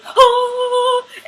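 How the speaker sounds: high, loud dramatic gasp. Retold or imitated. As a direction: gasp in high-pitched amazement. Acting out her reaction to the shot.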